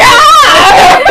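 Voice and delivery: high, loud mock scream; acted yell. Player acts out throwing a book at his face, mock yell. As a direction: yelp it with laughter behind it. Player voices a cry in play.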